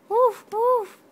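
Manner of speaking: put-on high, loud playful woof woof for the baby; dog barking. Shown as a demonstration. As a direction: bark like a puppy, high and loud. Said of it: "He goes".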